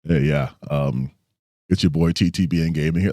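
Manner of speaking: low, quiet, offhand greeting; real-life voice. Pretend. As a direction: mumble a low, unenthusiastic channel intro. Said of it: "you hear me on the streets, I'm just like".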